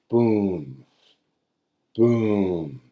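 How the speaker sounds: two slow heavy booms like pouring water; libation thuds. Shown as a demonstration. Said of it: "if you were doing a water libation".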